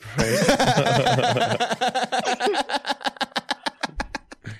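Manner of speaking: high, drawn-out real laughing fit; long laugh. Real, not acted. Host cracking up at a challenge to stick up for the team, long laugh. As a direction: burst out in high, rolling laughter.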